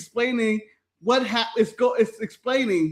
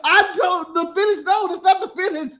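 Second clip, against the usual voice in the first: higher-pitched, mock desperate begging; frantic pleading; 4 semitones higher than usual. Retelling Chris begging Hunter about the finish, frantic pleading. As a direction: plead in a panic, amused underneath. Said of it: "they were so angry".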